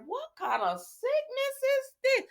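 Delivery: very high-pitched disbelief; mock outrage. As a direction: squeal it as an appalled question. Reacts to baby cries in ear.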